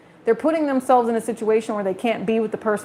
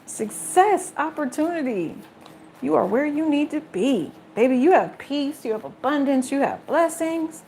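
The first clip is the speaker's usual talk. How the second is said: tender blessing; 4 semitones higher than usual. Real, not acted. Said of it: "blessing the viewers".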